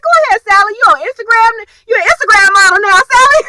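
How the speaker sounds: high, loud hype for a friend; gushing fan cheering Sally on; excited cheering. Retold or imitated. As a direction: cheer her on, squealing with delight. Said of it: "I was like".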